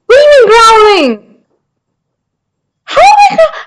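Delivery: high, loud squeal of disbelief; mock outrage. Streamer accused of growling, indignant and squealing. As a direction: protest it shrilly, playing offended.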